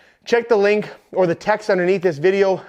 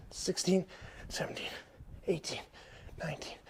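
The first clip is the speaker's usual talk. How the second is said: strained counting mid-lunge, much softer and barely voiced; breathless counting; 3 semitones lower, 17 dB quieter than usual. Real, not acted. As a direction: count through the effort, almost no breath left. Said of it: "I'm still out of breath".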